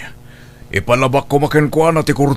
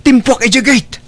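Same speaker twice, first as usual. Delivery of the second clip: urgent report; 8 semitones higher, 3 dB louder than usual. Acted. Reporting the thief escaped.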